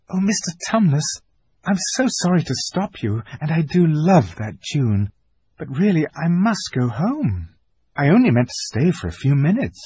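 put-on high, polite little girl apologising; a child politely excusing herself; young girl Lucy. Lucy apologises to Mister Tumnus, high polite girl.

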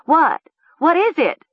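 very high, alarmed questions; high, panicky demand to know; shrill cry. A narrator's reading. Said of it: "Nessa cried shrilly".

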